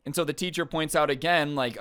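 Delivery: usual speaking voice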